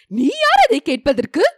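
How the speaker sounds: sharp, loud and high outburst; angry retort. A narrator's reading. Manimekalai angrily rebuking Vanathi. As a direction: snap back at her in fury. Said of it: "என்று ஆத்திரமாய் கூறினாள்".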